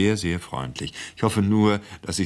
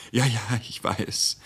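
slow, drawn-out, higher-pitched concession; resigned assent; 5 semitones higher, slower than usual. A narrator's reading. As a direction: give in with a show of reluctance. Buyer gives in with feigned regret.

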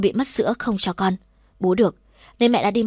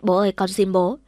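ordinary delivery, nothing marked

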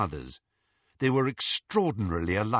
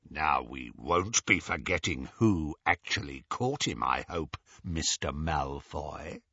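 menacing growler, dragging out the name; 7 semitones lower, 4 dB quieter than usual. A narrator's reading. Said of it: "said Greyback menacingly".

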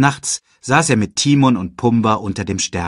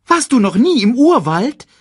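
high, quick cartoon meerkat; chirpy little sidekick voice; 13 semitones higher, 7 dB louder than usual. Timon asks in the jungle.

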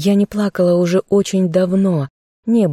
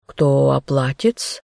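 low, slow, obsequious clerk; servile clerk voice; 3 semitones lower, slower than usual. Acted. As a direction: drawl it like a fussy servant. Clerk asking through artefact.

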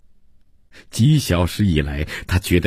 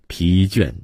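ordinary delivery, nothing marked

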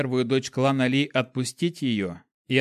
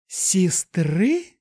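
sneering mock question, raised pitch; 6 semitones higher, 4 dB louder than usual. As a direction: toss the word back scornfully. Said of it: "переспросила она с издёвкой".

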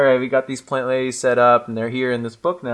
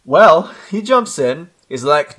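ordinary talk, nothing marked

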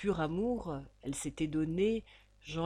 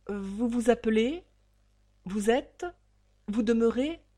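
curt questioning; 4 semitones higher, 5 dB louder than usual. Acted. Banker interrogates Ragdal.

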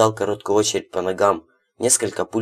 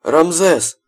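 calling out; 9 semitones higher, 6 dB louder than usual. A narrator's reading. Calling out to henchman.

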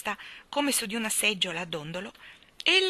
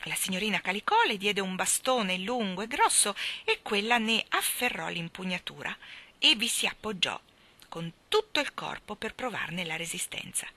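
ordinary delivery

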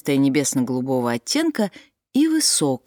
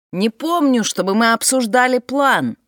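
raised voice, calling over the wind; shouting over wind; 7 semitones higher, 4 dB louder than usual. A narrator's reading. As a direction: shout it against a gale. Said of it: "прокричал Фрэнсис сквозь ветер".

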